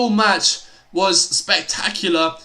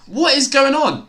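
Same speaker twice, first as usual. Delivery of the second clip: loud, high, incredulous call; raised stunned question; excited disbelief; 6 semitones higher, 4 dB louder than usual. Real, not acted. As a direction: shout it in real disbelief at the play. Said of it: "Nice voice crack".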